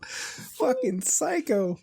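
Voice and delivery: slow, high, stretched-out psycho; drawn-out disbelief. Real, not acted. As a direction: drag it out in disbelief. Reacting to cremated healthy dog.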